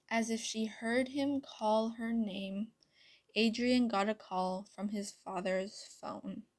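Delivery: ordinary delivery, nothing marked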